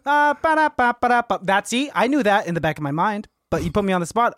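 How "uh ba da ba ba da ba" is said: sung-out nonsense rimshot; higher-pitched mouthed drum flourish; mock punchline flourish. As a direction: imitate a joke's musical sting. Mouths flourish after joke's punchline.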